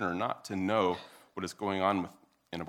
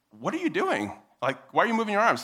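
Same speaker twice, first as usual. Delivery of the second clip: high, louder imagined onlooker's challenge; audience's bewildered questioning; 10 semitones higher, 6 dB louder, faster than usual. Pretend. Imagined audience questioning the speaker's moving arms, bewildered. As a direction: voice a puzzled listener, pitched up. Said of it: "you guys are like".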